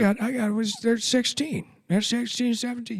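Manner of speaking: high-pitched, stammering old politician impression; Joe Biden. Comic mimicking the president in a video, muddled old-man voice. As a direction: stumble over the words like a muddled old man.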